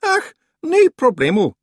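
loud, quick Scottish brush-off; hasty Scottish cover-up. A narrator's reading. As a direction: cover the slip fast. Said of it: "said the figure quickly".

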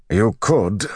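put-on gruff older soldier answering back. A narrator's reading. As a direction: concede it gruffly. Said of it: "Bryn said gruffly".

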